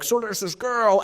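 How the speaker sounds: mocking high-pitched eager fan; high, nagging mock fan voice; pushy fan. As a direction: squeak it out like a pestering fan. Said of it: "they were like".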